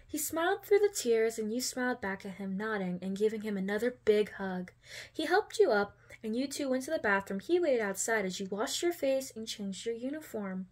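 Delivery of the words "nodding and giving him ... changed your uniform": ordinary delivery, nothing marked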